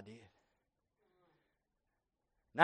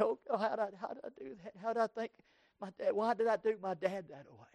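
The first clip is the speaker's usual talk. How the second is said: retold crying prayer; 5 semitones higher, 4 dB quieter, faster than usual. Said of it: "I'm in my room crying".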